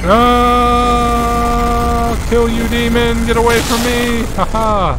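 very high put-on voice yelling at a demon; play-fight a demon in a squeaky-high yell; high-pitched mock battle cry. Player fends off a demon in the game, high mock cry.

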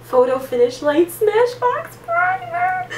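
high, slow and teary with joy; thrilled squeal. Real, not acted. Reading the primer's name to her twin, overjoyed. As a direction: squeal the name out, near tears.

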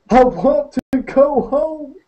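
very high, slow, wailing cry. Acted. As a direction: wail it like a lost child. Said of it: "he wailed".

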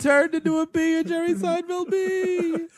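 squeaky stand-up bee voice; Seinfeld bee voice. Acted. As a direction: play the bee, whiny and put-upon. Bee voice joke about movie.

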